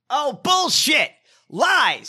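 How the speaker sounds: high, loud, slow cry of disbelief; scoffing. Real, not acted. Scoffing at Milo's apology, loud and drawn out. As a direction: drag out bullshit in loud scorn.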